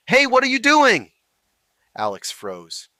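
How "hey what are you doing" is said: loud, high, harsh yell; sharp aggressive shout. A narrator's reading. Officer yells at bystander filming, sharp aggressive shout. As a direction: bark it across the street to freeze him. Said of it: "a sharp, aggressive voice cut through the evening air".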